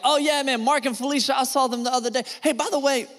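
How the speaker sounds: breezy gossiping friend before the secret; casual chatter. Retold or imitated. Friend chatting casually before whispering gossip, breezy and high. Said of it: "you're like".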